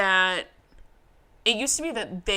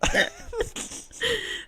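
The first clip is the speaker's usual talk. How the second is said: high wheezy laughter trailing down; trailing helpless laugh; high breathless laugh; 4 dB quieter than usual. Real, not acted. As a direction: laugh until out of air. Still laughing at joke.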